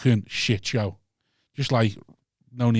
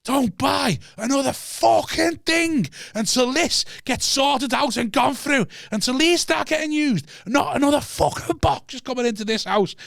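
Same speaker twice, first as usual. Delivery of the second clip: high, slow mock scream, much higher; retold screaming rant; 12 semitones higher, slower than usual. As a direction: re-enact yelling at the empty house, pitched way up and drawn out. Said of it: "I'm screaming at the top of my lungs".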